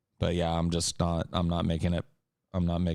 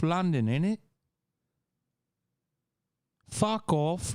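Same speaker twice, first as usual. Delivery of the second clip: high, cheeky mock London accent; 11 semitones higher than usual. Streamer goading chat with London innit in a mock British accent.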